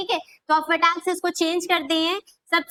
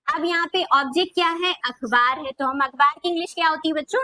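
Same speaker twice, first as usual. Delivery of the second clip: ordinary delivery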